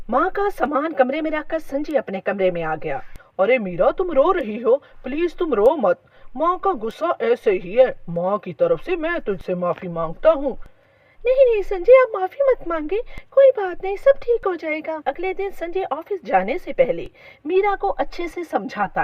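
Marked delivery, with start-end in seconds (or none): 3.4-10.6 lightly voiced husband, pitch easing down to a low apology; a hint of a soothing husband; gentle apologetic husband. A narrator's reading. Husband consoles his crying wife, lightly voiced and soothing. 11.2-15.0 put-on forgiving young wife, pitched high; high, reassuring wife who waves off the apology; Meera. A narrator's reading. Wife tells husband not to apologise, high and reassuring.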